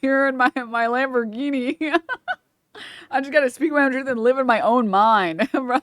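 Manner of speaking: high mock wise-guru voice, laughing. Streamer mocking the guest's talk as guru wisdom, laughing. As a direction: parody a smug self-help guru, half laughing. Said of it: "it's giving knowledge".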